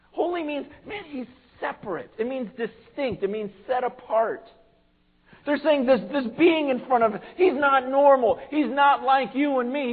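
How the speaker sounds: usual speaking voice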